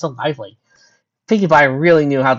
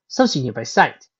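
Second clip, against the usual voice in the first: ordinary delivery, nothing marked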